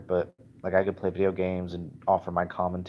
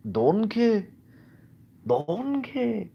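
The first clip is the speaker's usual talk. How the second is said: much higher, stretched Scottish call; Shrek; 5 dB louder, much slower than usual. Acted. Vlogger showing his only Scottish, high ogre impression. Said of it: "pretending to be like Shrek".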